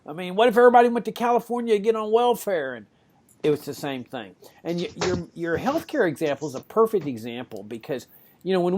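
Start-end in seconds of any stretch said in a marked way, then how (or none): 0.0-2.7 high, fast mock panic. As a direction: imitate people freaking out. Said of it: "people would freak out".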